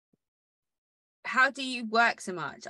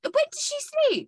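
incredulous. Real, not acted. Disbelief at busy schedule.

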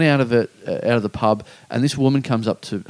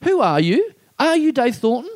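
higher, suspicious rising questions; doubting fan; 11 semitones higher than usual. Retold or imitated. A fan posing for a photo with Dave, high suspicious questions. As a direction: squint and question him mid-photo.

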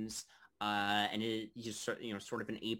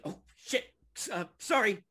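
startled brawlers; 8 semitones higher, 5 dB louder than usual. Acted. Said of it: "they're like".

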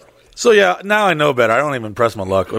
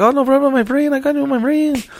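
put-on high-pitched, easygoing Mexican-accented operator; imitated Mexican accent; 10 semitones higher than usual. Mexican operator to worried rider, breezy mock Mexican accent. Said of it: "the Mexican dude was like".

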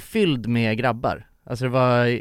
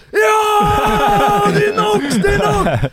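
much higher, louder yelled Nox; triumphant; 4 dB louder, much slower than usual. Retold or imitated. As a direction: cheer it out like a winner. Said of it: "Ja, men är det Nox?".

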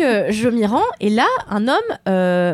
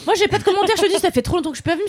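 mock diva; 8 semitones higher, faster than usual. Star guest returning, mock diva.

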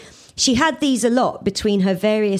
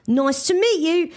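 higher, lightly sing-song reply; chimed; 7 semitones higher than usual. A narrator's reading. Ian chimes a friendly reply to his new neighbour. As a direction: chime it brightly. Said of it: "chimed Ian".

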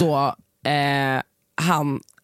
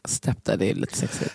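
low, soft teasing whisper; 6 semitones lower, 4 dB quieter than usual. Pretend. Joking to her co-host that stepdads are sexy, whispered. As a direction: confess it in a sly whisper.

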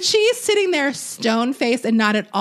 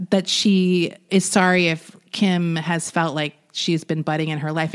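ordinary delivery

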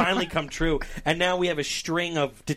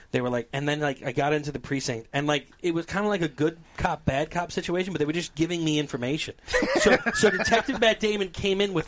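usual speaking voice

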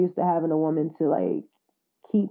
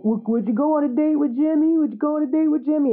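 teasing; 7 semitones higher than usual. Retold or imitated. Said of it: "And she started blushing".

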